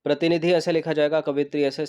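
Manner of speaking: normal talking voice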